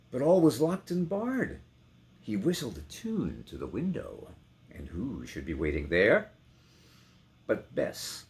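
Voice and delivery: rolling, theatrical reading with big swells; dramatic poem recitation. Acted. Actor reading The Highwayman to his sons, swelling and dramatic. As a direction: declaim it like a ghost story by the fire.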